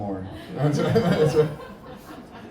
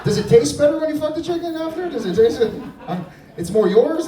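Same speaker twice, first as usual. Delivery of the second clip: high and loud, laughing through it; laughing tease; 12 semitones higher, 7 dB louder than usual. Real, not acted. Comic teasing the chicken farmer, laughing loud. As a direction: needle them while cracking up.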